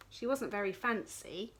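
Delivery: usual speaking voice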